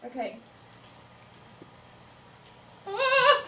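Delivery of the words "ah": high-pitched frightened cry; nervous scream. Real, not acted. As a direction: cry out in jumpy anticipation. Scared of what it will do.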